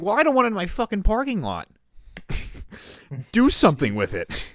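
loud, exasperated demand; retold angry outburst. Telling them to clear the parking lot, loud exasperation. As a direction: let rip as in an acted-out argument. Acting out own angry reply.

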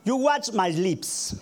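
slow, exaggerated lip-reading English; over enunciating. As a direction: spell each word out with the mouth. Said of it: "como dice el, el americano".

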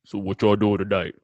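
a hint of Morgan Freeman with strep throat; lightly done rough, booming dad; raspy dad voice. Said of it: "a Morgan Freeman ... with, like, strep throat".

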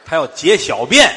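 放慢放大声说清楚; 冲着对方大声慢慢讲明白; 响亮的慢速解释. Acted. 大声解释老头的话. (大声慢慢说清楚老头的意思)

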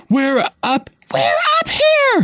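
shrill high scream for help. A narrator's reading. Hermione screams for help to those downstairs. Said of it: "Hermione screamed suddenly".

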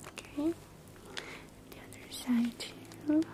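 ordinary delivery

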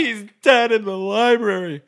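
high, loud, slow, still shaken; distraught answer. Acted. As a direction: choke it out.

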